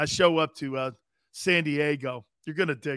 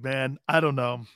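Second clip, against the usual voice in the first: ordinary talk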